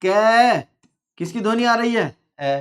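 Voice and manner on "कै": कै held long on its final vowel; drawn-out syllable. Shown as a demonstration. Teacher testing pupils on the end sound, कै drawn out. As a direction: stretch कै out evenly. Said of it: "तो इसमें क्या बोलोगे".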